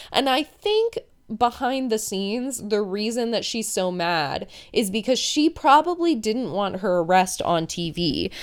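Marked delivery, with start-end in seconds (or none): none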